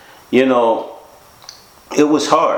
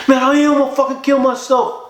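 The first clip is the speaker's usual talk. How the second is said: high, wailing retold insistence; imitated crying despair. Crying friend insisting to disbelieving caller, imitated anguish. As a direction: imitate a desperate man in tears, pitched way up. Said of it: "He was crying".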